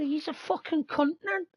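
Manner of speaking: very high childlike voice, imitating a boy; little kid swearing. Imitating a little kid cursing at his nan, high and childlike. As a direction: pitch it way up like a small boy.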